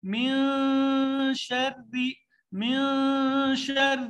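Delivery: من with the نون hidden in a nasal hum; nasalized noon. Shown as a demonstration. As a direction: glide the نون of من into شر with ghunna. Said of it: "نون کی آواز بالکل واضح نہیں ہے".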